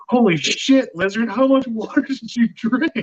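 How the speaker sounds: high-pitched, astonished joke punchline; mock astonishment. Monkey to alligator he mistakes for lizard, mock astonishment. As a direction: pitch it way up in comic disbelief.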